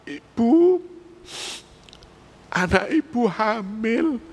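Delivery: "Ibu" burst out high, then slow heavy news; grave headmaster. Pretend. Headmaster telling a mother her daughter is pregnant, grave and slow. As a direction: deliver the shock slowly after a sharp call. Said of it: "Sampean dipanggil kepala sekolah".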